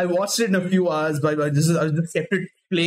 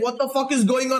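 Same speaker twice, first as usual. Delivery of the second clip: higher-pitched, baffled reaction; retold bewilderment; 6 semitones higher than usual. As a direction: act out being thrown by a plot twist. Acts out bewilderment while reading.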